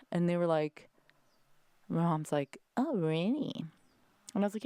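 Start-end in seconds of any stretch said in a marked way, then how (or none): 2.8-3.6 a hint of mom, higher; lightly voiced mother's surprise; imitating mom. Daughter replaying mom's reaction at dinner, lightly voiced. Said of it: "I impersonate you guys".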